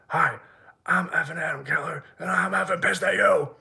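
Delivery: high-pitched mock-angry phone greeting; mocking imitation. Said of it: "to make fun of me".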